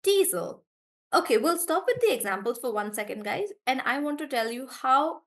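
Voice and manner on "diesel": diesel with di stressed and high. Shown as a demonstration. Teacher models diesel with the first syllable stressed. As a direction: punch di in diesel. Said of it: "so stress on D".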